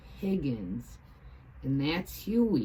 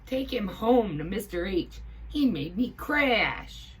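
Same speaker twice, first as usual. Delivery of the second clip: angry pilot; 6 semitones higher, 7 dB louder than usual. A narrator's reading. Furious pilot continues.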